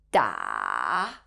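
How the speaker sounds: "Da" dipping low, then climbing back up; falling-rising tone. Shown as a demonstration. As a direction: draw "Da" as a check mark, down then up. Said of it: "follows a check mark sign by falling down first before going back up".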